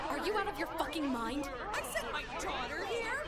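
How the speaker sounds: loud, high, furious disbelief; outraged parent. Acted. Outraged parent shouting at the school staff, loud and high. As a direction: blow up at the school for failing her daughter.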